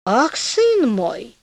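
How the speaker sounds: slow, drawn-out mild reproach; gently scold, stretching the words out; a padre's understated reproach, very slow; reproachful. A narrator's reading. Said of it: "укоризненно сказал падре".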